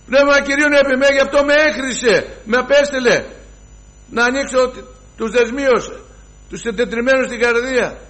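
high, loud, fast solemn proclamation; proclaiming scripture. Acted. Christ reading the prophecy in his village, loud proclamation. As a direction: ring it out like scripture read aloud.

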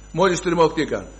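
ordinary talk, nothing marked

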